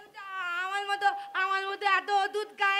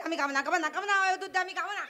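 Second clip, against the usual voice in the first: rapid, whiny repeated refusal; sulky girl refusing milk; fast childish refusal; much faster than usual. Acted. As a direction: rattle it off like a stubborn child.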